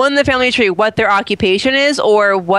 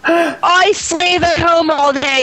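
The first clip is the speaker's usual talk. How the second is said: aggressive boss; 6 semitones higher than usual. Acted. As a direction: order them around like a nagging spouse. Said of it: "like, aggressive".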